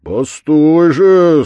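loud, slow warning; blacksmith's loud menace; threatening shout. A narrator's reading. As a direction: stretch it out as a threat. Blacksmith threatens the captured devil.

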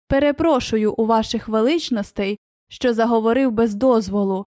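ordinary delivery

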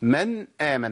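"من آمن" with ن closed, then a clean hamza; clear still noon. Shown as a demonstration. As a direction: separate "من" from "آمن" with no vowel between. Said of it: "أما أقول". (إظهار النون الساكنة في "من آمن")

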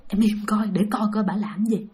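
soft, low whisper telling her to hush. Retold or imitated. Brother hushes his sister while watching the old woman: soft whisper. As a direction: keep it down, we're spying.

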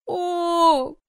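high, loud, drawn-out gasp of wonder; big stunned exclamation; astonished exclamation. Acted. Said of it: "поражённо воскликнула я".

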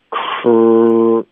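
K exploded from the tongue root into a held pouted r; K burst into R. Shown as a demonstration. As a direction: burst "krr" on k, lips already pouted. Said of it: "从舌根爆一个". (k 从舌根爆进 r)

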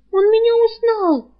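high, loud alarmed cry. Acted. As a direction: panic as the danger sinks in. Frightened girl realizes she's recognized.

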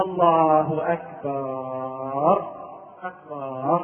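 low, very drawn-out takbir, أكبر as akbaar; slow mock imam's falling takbir; imam signalling sitting. Shown as a demonstration. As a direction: sink low and stretch the end of أكبر. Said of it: "يريد أن يبين للناس أنه يريد أن يجلس".

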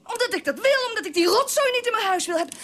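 indignant outburst, pitch up; angry, high. Acted. Wife rages at husband about the recorder. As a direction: spit out "rotzooi" in outrage.

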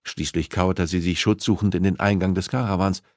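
usual speaking voice

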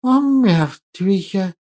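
slow, quiet drawn-out hiss; hissing creature voice. Acted. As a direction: hiss the words out slowly. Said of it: "Перевёл я его шипение".

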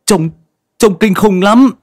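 a scared youth blurting out what he saw; terrified stammer. A narrator's reading. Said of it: "giọng lắp bắp". (lắp bắp hoảng sợ, giọng cao vống)

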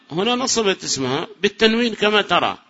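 ordinary delivery

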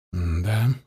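low, pensive yes; thoughtful. A narrator's reading. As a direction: say it quietly, musing. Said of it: "задумчиво произнёс иранец".

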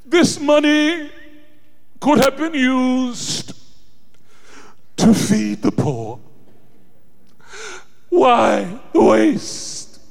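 slow, high, sanctimonious Judas. Retold or imitated. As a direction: drag it out self-righteously. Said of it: "in sanctimonious tones".

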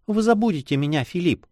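put-on girlish voice with a touch of reproach; sad young girl. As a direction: plead lightly as a girl at parting. Girl fears lover will forget her.

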